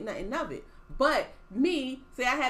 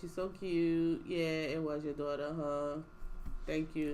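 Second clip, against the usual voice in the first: sweet quiet note; 7 semitones lower, 8 dB quieter than usual. Retold or imitated. Friend's polite note to Taylor, soft and sugary. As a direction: say it sweetly under your breath. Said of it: "Just like".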